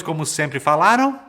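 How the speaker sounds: ordinary delivery, nothing marked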